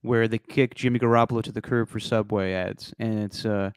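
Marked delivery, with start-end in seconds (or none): none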